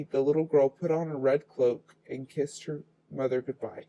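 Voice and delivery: ordinary delivery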